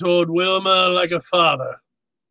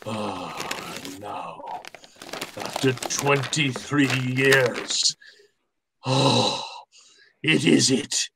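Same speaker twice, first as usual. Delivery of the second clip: much softer, slow and reverent; awed hush; 4 semitones lower, 5 dB quieter, slower than usual. Acted. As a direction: breathe it out in awe. Gutman awed by the falcon.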